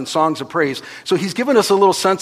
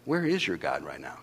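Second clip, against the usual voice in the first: much lower, softer menacing sneer; Satan taunting; 12 semitones lower, 11 dB quieter than usual. Retold or imitated. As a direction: whisper the taunt low like the devil. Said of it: "He's like".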